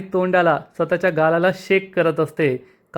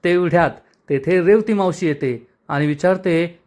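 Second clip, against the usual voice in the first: usual speaking voice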